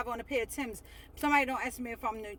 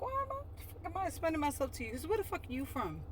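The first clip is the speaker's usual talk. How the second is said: muttered inner thought; 4 semitones higher, 6 dB quieter, faster than usual. Retold or imitated. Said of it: "I'm like".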